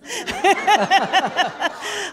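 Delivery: high, loud nervous giggle; nervous laugh. Real, not acted. Student laughs nervously at the class, high and loud. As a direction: laugh off the nerves. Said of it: "A little nervous".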